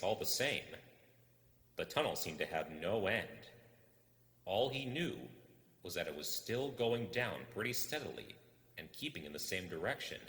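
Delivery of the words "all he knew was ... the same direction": plain reading voice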